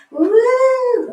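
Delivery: high excited whoop; cheering woo. Real, not acted. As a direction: celebrate with a big woo. Cheer for Women's Day.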